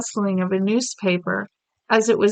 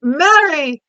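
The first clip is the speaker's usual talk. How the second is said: sharp, higher, louder cry of the name; sharp call; 7 semitones higher, 7 dB louder than usual. Acted. The Queen calling sharply as the maid drops the tray. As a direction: snap the name out in alarm. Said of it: "rather sharply".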